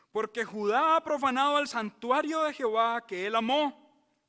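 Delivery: loud, high fervent preaching; full-force sermon delivery. Acted. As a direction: drive it home from the pulpit. Preaching, raised voice.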